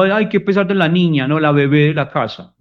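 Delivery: mocking a partner who asks for help; mocking baby talk. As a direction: belittle them like a helpless baby. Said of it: "genera sentimiento de humillación".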